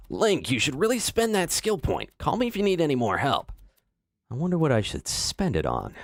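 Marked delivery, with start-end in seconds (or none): 0.1-3.5 higher, chirpy helper voice; mock game helper. Streamer mocking the in-game helper nagging Link, higher. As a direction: nag like a game guide character. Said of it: "Link, that's a boss nearby".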